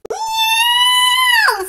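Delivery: high, loud meow; a cat crying out loud and high. Acted. The cat on the rug, crying a loud high meow. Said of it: "says the cat".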